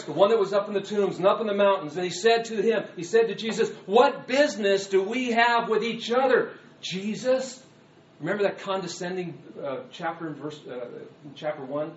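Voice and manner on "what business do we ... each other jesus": raised, higher-pitched challenge; loud shouting. Acted. Possessed man confronting Jesus, shouting loudly. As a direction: shout it as an accusing question. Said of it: "he was shouting with that loud voice".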